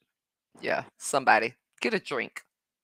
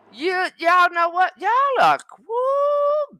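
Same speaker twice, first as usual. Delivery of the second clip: exasperated high squeal; 12 semitones higher, much slower than usual. Real, not acted. Streamer squealing at her chat in disbelief. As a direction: voice shoots way up in disbelief.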